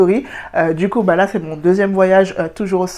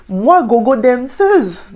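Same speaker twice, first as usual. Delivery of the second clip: drawn-out, higher, louder scoff; mock outraged disbelief; 6 semitones higher, 7 dB louder, much slower than usual. Real, not acted. Narrator scoffs at the old man's job offer, outraged disbelief. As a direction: scoff at the very idea, slowly.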